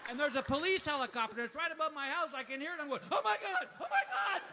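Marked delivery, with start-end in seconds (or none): 3.1-4.4 high, loud terrified wail; hammed-up paranoid outburst; comic panic. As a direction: shriek it as if the police are coming. Stoned panic at police helicopter.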